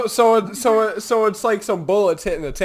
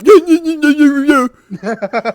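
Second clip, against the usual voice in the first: higher and louder mumbling yeahs; imitating a coworker; 9 semitones higher, 10 dB louder, slower than usual. Coworker nicknamed Cottonmouth, imitated repeating yeah. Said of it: "We'd call him Cottonmouth 'cause he's like".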